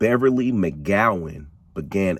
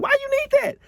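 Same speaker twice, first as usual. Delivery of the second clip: put-on high, suspicious girlfriend voice; voicing Beverly; faster than usual. Beverly asking Alice why she needs her passport, high and suspicious. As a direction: pitch it way up, puzzled and wary. Beverly questions the odd request.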